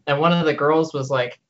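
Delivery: ordinary talk, nothing marked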